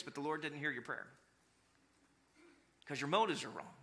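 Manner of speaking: normal talking voice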